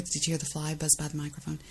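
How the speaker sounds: ordinary delivery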